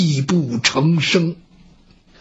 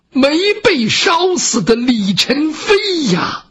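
tearful revelation; 7 semitones higher, slower than usual. Acted. 李娘娘含泪相认.

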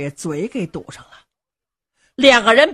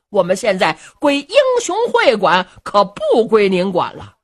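plain reading voice

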